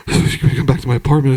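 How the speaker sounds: low, mumbled invitation; low talker. Retold or imitated. Jeff inviting the group home, low mumble. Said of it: "Jeff was a low talker".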